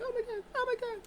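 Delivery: very high squealing Oh my God; mock squeal. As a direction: shriek it in a falsetto. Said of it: "she was like".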